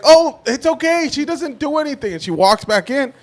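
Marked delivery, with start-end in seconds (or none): 0.0-2.1 owner's voice. Retold or imitated. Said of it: "she's like".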